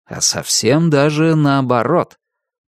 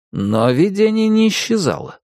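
usual speaking voice

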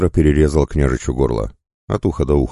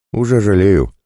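higher-pitched, mocking retort; amused bandit, pitched up; smirking; 7 semitones higher, 4 dB louder than usual. A narrator's reading. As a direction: toss it back with a smirk. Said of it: "ухмыльнулся Косой".

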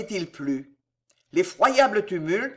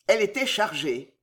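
ordinary delivery

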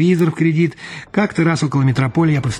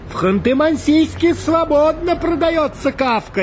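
very high, drawn-out call; high-pitched hail from far away; shout from afar; slower than usual. A narrator's reading. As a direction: call out slowly and high across a distance. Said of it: "издали закричал он".

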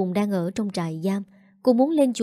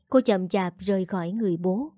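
plain reading voice

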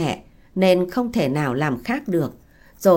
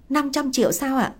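higher-pitched, surprised query; mildly surprised question; 7 semitones higher than usual. A narrator's reading. Young bride asks the woman about five hundred million, mildly surprised. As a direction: ask in mild disbelief, pitch raised. Bride learns the price paid.

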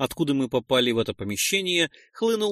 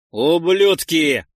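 slow, louder drawn-out curse; raging at the stone; furious exclamation; 3 semitones higher, 8 dB louder, much slower than usual. Acted. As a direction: spit the word out as you strike. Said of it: "воскликнул он".

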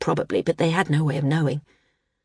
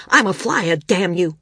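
defiant shout; 3 dB louder than usual. A narrator's reading. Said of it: "San said loudly".

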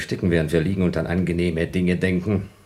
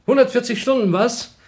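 high, louder mock-cheerful sarcasm; bitter sarcasm; 7 dB louder than usual. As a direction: force a cheerful tone over despair. Bitter irony about cheerful thoughts.

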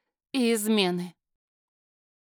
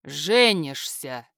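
much lower, slow commanding word; a flat paternal order, drawn out; stern father; 8 semitones lower, much slower than usual. A narrator's reading. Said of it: "повысил голос его отец". (низкий медленный отцовский приказ)

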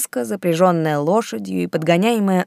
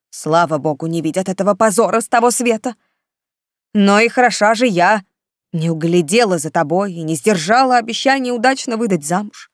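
indignant scolding; 4 semitones higher, 4 dB louder than usual. Acted. Aunt scolds niece over pregnancy.

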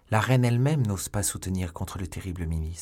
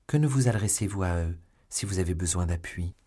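plain reading voice

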